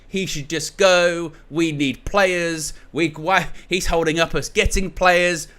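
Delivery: hammed-up outraged supporter; mock angry rant. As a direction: rant like a betrayed fan, slowing on the demands. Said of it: "started cussing him all out, going".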